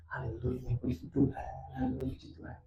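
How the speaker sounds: near-whispered, hollow praise; hushed praise. Retold or imitated. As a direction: murmur it under the breath, imitating her. Wife's pained praise before congregation. (pujian lirih nyaris berbisik)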